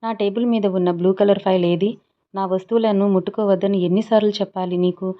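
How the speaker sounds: usual speaking voice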